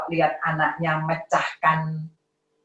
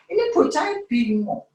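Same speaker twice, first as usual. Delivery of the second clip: put-on confused child; 8 semitones higher than usual.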